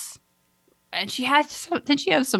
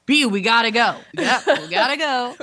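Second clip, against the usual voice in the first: urgent mock quote; 6 semitones higher than usual. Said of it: "so she's like".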